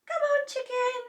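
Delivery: put-on high, coaxing boy's voice; coaxing chicken. A narrator's reading. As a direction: lure the bird gently.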